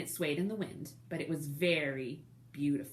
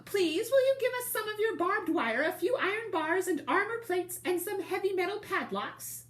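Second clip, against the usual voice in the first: much higher little wolf voices, polite; squeaky little wolves asking; 12 semitones higher than usual. Acted. Little wolves politely begging the rhinoceros driver, high and squeaky. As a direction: ask sweetly in a tiny high voice.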